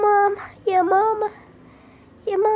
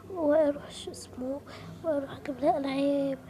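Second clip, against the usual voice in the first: usual speaking voice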